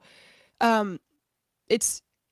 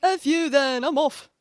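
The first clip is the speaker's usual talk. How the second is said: loud, raised mock outburst; mock angry exit; 4 semitones higher, 6 dB louder, slower than usual. Streamer mimicking an Orthodox chatter storming off, mock angry. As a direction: imitate a huffy chatter, louder and higher.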